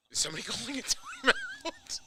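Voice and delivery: asking through a laugh. Real, not acted. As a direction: laugh while you ask. Asked again, whispered the second time.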